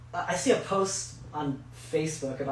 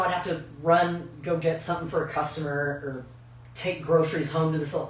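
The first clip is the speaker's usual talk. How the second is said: ordinary talk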